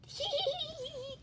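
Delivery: high, light acted giggling; high "hee hee hee"; ticklish giggle. Snake's tittering laugh. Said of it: "The snake laughed".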